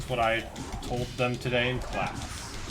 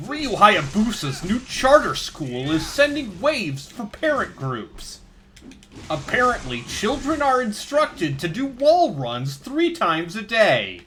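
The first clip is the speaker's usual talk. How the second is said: slow, higher TV reporter read; news reporter voice; 8 semitones higher, 4 dB louder than usual. Acted. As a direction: play a cheesy local news anchor. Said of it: "That's a good bad reporter voice".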